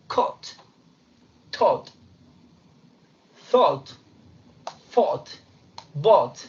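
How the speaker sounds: caught, Taught, Thought, Fought, Bought con una O redonda británica. Shown as a demonstration. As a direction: pronuncia cada pasado con una O clara y cerrada. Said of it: "en inglés británico va a ser O". (con la O británica)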